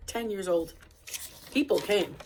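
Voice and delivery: ordinary delivery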